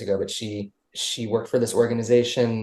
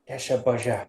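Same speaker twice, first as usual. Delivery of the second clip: lightly put-on voice for talking to a dog; 3 dB quieter than usual. Shown as a demonstration. Owner showing the host how he talks to his dog, lightly put-on voice. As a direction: slip a little into a silly pet voice. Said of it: "I've got a lot of different voices for her".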